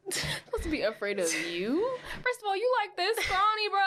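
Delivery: high, incredulous hammed-up challenge; mock indignant retort. Acted taunting retort to harassers.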